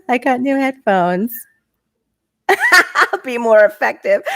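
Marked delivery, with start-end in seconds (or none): 2.0-4.2 high, slow, laughing delivery; amused, high-pitched, drawn-out words. Real, not acted. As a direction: laugh your way into it. Laughing at chat comment.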